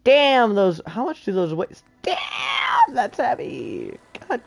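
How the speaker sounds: loud shocked cry of damn; shocked yell. Real, not acted. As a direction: blurt it out in disbelief. Shocked at armor weight.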